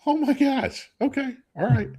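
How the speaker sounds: high-pitched retold disbelief; mock disbelief. Husband retelling his reply to wife, high-pitched mock disbelief. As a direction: replay your stunned reaction in a high little voice.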